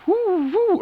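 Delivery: high, loud whooping cries; high mock whooping. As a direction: whoop like she's twirling something. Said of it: "she's going".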